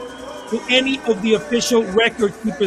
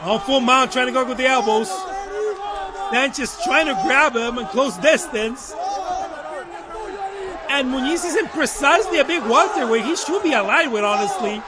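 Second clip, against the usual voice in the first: play-by-play; 4 semitones higher than usual. Real, not acted. Live play-by-play of a fight.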